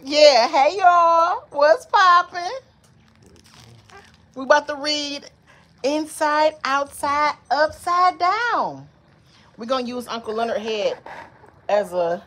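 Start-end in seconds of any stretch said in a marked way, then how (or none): 0.0-2.4 shouted high hey y'all; loud high greeting. Acted. Mom greeting viewers for baby, loud and high. 5.8-8.9 reading title aloud. Acted. Reading book title to baby.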